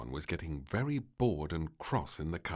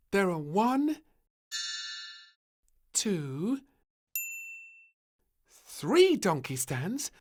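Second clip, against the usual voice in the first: put-on counting child; 6 dB louder, slower than usual.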